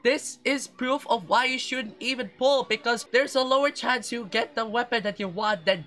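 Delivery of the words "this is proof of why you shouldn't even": frustrated rant. Real, not acted. Player ranting at the banner for another Skyward Harp, furious. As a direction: rage at the game, cracking into shouts. Ranting about unwanted weapon.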